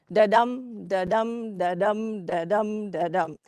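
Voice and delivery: each "Da-dum" chanted with the dum stressed, a steady heartbeat pulse; rhythmic da-dum. Shown as a demonstration. Lecturer demonstrating iambic pentameter to the class, rhythmic chant. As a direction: tap out the iambic beat like a heartbeat. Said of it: "So he wrote in five heartbeats".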